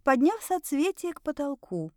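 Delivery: plain reading voice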